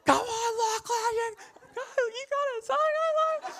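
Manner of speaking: high, slow, squeaky cheer from the sidelines; girlfriend cheering. Retold or imitated. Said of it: "I'm so used to".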